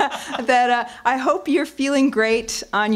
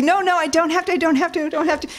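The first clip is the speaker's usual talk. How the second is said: much higher, insistent protest; high flustered denial; 10 semitones higher, faster than usual. Retold or imitated. Protesting to her teasing colleagues, high flustered denial. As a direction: protest too much, squeaky. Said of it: "I'll be".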